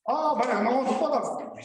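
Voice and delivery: high-pitched imitation of a trespasser; mock protest. Landowner mimics trespassers caught near his pit, mock protest. Retelling trespassers' reply to him.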